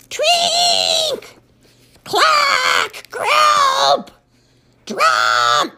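very high shouted honks; elephant noise. Acted. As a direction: honk each like a trumpet. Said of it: "I'm trying to speak elephant".

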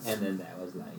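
low, hushed scripture narration; Morgan Freeman. Acted. Mock audio Bible as read by Morgan Freeman, low soft narrator. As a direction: let it sink low and soft, like holy text. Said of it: "Morgan Freeman audio Bible".

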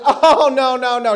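loud, high no no no; stern driver. Retold or imitated. Bus driver cutting off a screaming passenger, loud and stern. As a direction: shut him down like a no-nonsense driver.

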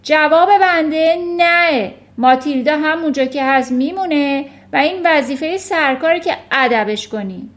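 very loud, high accusing shout; angry shouting. A narrator's reading. Headmistress overruling teacher about the girl, angry shout. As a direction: shout her down forcefully. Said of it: "فریاد کشید".

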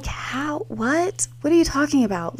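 high, baffled questioning; acted bafflement. Baffled reply to sister's accusation.

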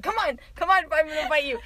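playful fight taunt. Retold or imitated. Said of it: "I'm like".

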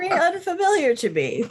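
high, laughing repeat. Real, not acted. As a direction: laugh through the line.

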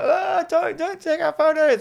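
very high, squealed plea; mock panic. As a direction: plead in a silly high voice. Ghosts fending off the camera.

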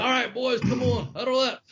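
high coach's holler to huddle up; imitating the coach's huddle call; coach calling the team. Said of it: "He's like a damn coach".

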